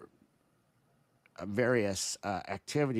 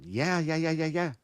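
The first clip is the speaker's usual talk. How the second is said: higher-pitched, rapid-fire contradiction; retold stubborn comeback, pitched up; insistent retort; 6 semitones higher than usual. Citizen answering the officials' refusals, stubbornly insistent.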